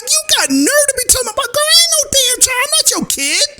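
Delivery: high, half-crying mock outrage. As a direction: squeal it like an offended lady who won't be treated as a kid.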